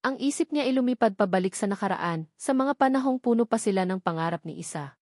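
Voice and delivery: usual speaking voice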